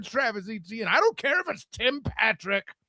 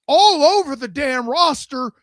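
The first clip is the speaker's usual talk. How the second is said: loud sharp voice; 5 semitones higher, 5 dB louder than usual. Real, not acted. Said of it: "why are you yelling".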